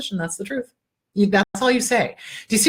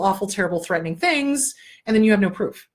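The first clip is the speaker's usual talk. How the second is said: ordinary talk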